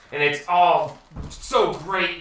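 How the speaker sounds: swelling with triumph, slow; triumphant. A narrator's reading. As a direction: let the joy swell. Triumphant dream scene, building joy.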